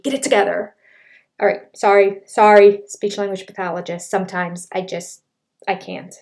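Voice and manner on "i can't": ordinary delivery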